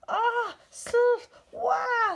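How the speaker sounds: high, loud panicked yelp; shrieking fright cry. A narrator's reading. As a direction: yelp in terror before running. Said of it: "turned and ran".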